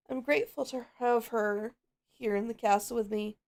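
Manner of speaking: ordinary talk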